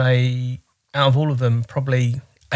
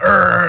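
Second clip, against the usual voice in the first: mock growl; 6 semitones higher, 4 dB louder than usual.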